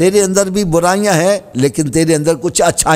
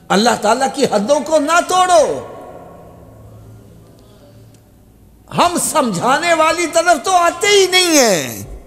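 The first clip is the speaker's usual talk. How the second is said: high, impassioned pulpit pleading; full-force sermon voice; fiery preaching; 8 semitones higher than usual. Acted. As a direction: beg them to use sense.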